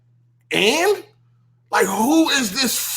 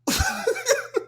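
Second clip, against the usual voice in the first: squeaky laugh; 6 dB quieter than usual. Real, not acted. High soft laugh.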